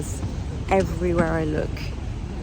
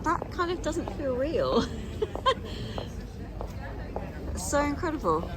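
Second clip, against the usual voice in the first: awed laughing; 7 semitones higher, 8 dB quieter than usual. Real, not acted.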